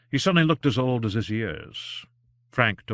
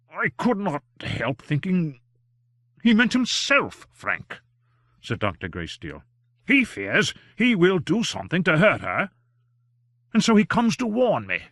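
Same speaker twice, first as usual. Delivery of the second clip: higher, anxious fatherly doctor voice; worried old doctor; 8 semitones higher, 3 dB louder than usual. A narrator's reading. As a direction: plead with him kindly to come inside. Greysteele voiced by reader.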